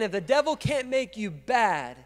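normal talking voice

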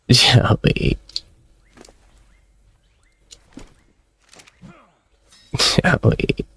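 breaking, voiceless whimper of a name; hammed-up tearful wail with no voice left; mock sobbing. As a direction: whimper the pet's name in grief. Mourning the dead game pet.

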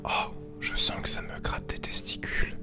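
ordinary talk, nothing marked

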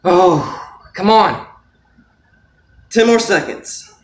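strained, loud, effortful voice; holding a plank. Real, not acted. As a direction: push the words out while straining. Straining through a five minute plank.

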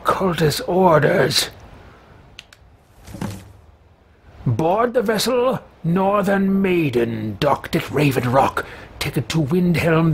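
slow, grand sinister reading; villain reading orders. Pretend. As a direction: read the orders like a dark herald. Reads orders in role voice.

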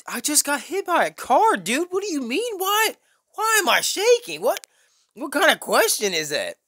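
very high, squeaky disbelief; worked-up retold outrage, voice shooting way up; incredulous outburst. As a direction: protest as if the question is absurd. Retelling his outraged reply to cop.

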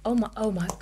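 ordinary talk, nothing marked

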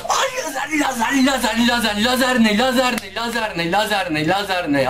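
high panicked repetition, gradually coming down; panicked outburst. Real, not acted. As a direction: blurt it high in startled panic, then sink. Sudden laser attack in game. (panikle tiz sesle tekrarlama)

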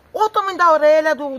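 retold cry out. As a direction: cry out as you retell the shock. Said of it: "eu gritei".

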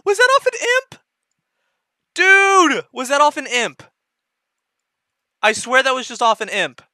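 high, loud amazed shouting; can't believe your luck; stunned disbelief. Real, not acted. Disbelief at rare drop.

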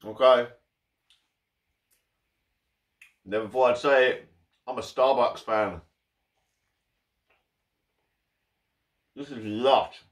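chewing while talking; talking between bites; mouth full. Real, not acted. Seen 1: holds toastie at mouth, bites and chews between words throughout.

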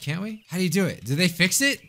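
ordinary talk

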